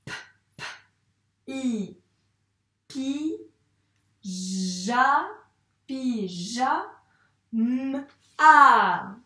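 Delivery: "pi", "ja", "ma" each said alone; sounding out words. Shown as a demonstration. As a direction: sound out "pyjama" syllable by syllable. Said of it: "on va faire syllabe par syllabe".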